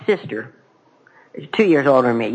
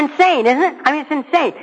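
much higher, amused disbelief; 10 semitones higher than usual. Real, not acted. Speaker mocking his own fears, high and amused. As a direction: say it with a laughing lilt.

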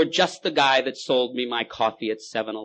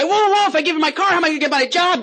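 much higher, louder panicky whoa; panicked drunk; 9 dB louder than usual. Acted. Drunk panicking about losing his job, much higher. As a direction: squeal in alarm. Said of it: "And they".

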